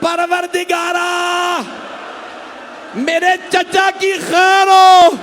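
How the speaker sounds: high, loud, slow weeping plea; a little girl praying through tears, drawn out; tearful prayer. A narrator's reading. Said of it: "रो के कहा". (ऊँची, धीमी, रोती हुई दुआ)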